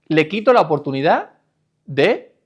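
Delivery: ordinary delivery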